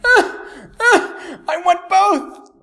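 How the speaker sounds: high, loud crying plea; mock-anguished wail of someone torn between two. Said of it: "you're kind of like".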